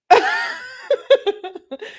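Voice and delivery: long high peal of laughter; real laugh. Real, not acted. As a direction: crack up, high and helpless. Laughs at chat's remark.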